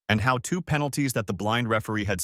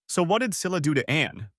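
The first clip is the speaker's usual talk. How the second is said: demanding; 6 semitones higher than usual. Acted. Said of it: "I demanded".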